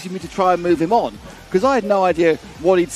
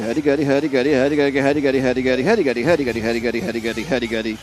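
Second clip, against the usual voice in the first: lightly hammed-up Finnish babble, rambling on and fading; mock Finnish gibberish; 3 semitones lower than usual. Mimicking a driver answering in Finnish, mock Finnish babble. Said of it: "he'd obviously Finnish".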